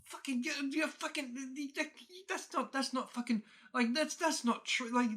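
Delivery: high, loud, sputtering mock outburst; short-tempered dad. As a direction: imitate an exasperated dad ranting in disbelief. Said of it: "short temper of him goes".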